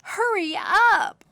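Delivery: high, slow urgent yell. Acted. Katie urging her brother on, high yell. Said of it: "yelled Katie".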